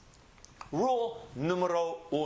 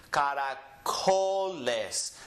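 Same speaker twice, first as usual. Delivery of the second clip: "caracoles" slowed, with "co" held long; drawn-out teaching demo of "caracoles", emphasis on "co"; stress on CO; 3 semitones lower, much slower than usual. Shown as a demonstration. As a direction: stretch and lean on the "co" in "caracoles". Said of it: "The co gets emphasized".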